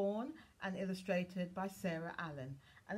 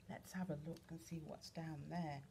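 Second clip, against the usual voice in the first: usual speaking voice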